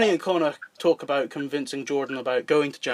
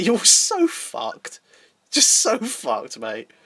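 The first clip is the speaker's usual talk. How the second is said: slow gloating; 3 semitones higher, much slower than usual. Acted. Michael mocking Jordan.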